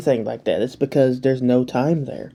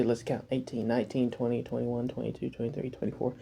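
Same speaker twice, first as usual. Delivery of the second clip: counting under breath; 11 dB quieter than usual. Real, not acted. Counting hours under his breath.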